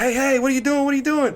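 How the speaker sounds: high, loud hurried yelling; playing Colby, shocked at the mess; mock alarmed Colby. Colby calling out to guy.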